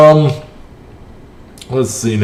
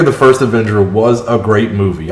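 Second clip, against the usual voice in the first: normal talking voice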